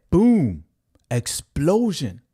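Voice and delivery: loud, high boom then slow explosion; loud high excited boom, stretched explosion. Real, not acted. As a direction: hype the price spike. Hyped price jump.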